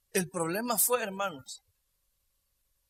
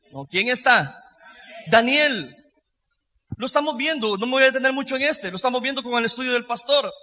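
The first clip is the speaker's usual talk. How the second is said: ordinary talk, nothing marked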